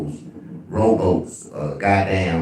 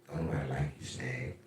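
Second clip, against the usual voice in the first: much softer, lower self-mocking aside; muttered self-roast; 4 semitones lower, 16 dB quieter than usual. Real, not acted. Streamer roasting himself over few likes. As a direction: mumble a jab at yourself. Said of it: "self-mocking aside".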